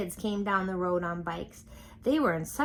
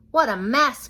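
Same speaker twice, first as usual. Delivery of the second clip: louder, higher dismayed cry; cried; 7 semitones higher, 9 dB louder than usual. A narrator's reading. Father seeing spaghetti on Froggy, loud dismayed cry. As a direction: exclaim at the mess. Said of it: "cried his father".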